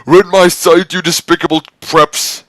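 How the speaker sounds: high, loud shouted insult; high, loud outraged shout. Acted. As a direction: hurl it at the preps. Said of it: "he shouted".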